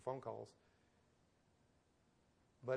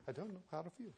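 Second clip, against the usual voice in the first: put-on light Scottish accent, bewildered; a hint of Scottish lilt; 4 semitones higher, 6 dB quieter, much faster than usual. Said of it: "In his wonderful, uh, Scottish accent".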